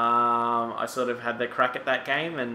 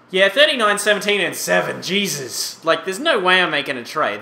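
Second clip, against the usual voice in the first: excited; 7 semitones higher, 7 dB louder than usual. Real, not acted. Gamer excited over player stats.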